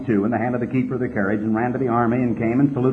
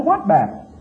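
scoffing question, pitched higher; as if no battle ever happened; incredulous; 8 semitones higher than usual. Acted. Scoffing at the brother's claim.

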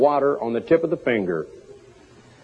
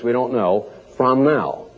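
usual speaking voice

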